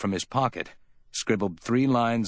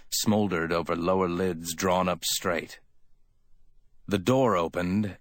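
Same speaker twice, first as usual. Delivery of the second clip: usual speaking voice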